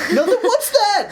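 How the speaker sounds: very high, laughing challenge; mock accusing. Clerk challenges man hiding steak in pants, accusing. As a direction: point out the evidence in mock disbelief. Acts out questioning a shoplifter.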